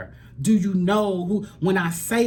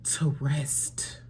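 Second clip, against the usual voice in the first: soft whispered words; 3 semitones lower, 6 dB quieter than usual. Real, not acted. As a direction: whisper the message gently. Emotional whispered message.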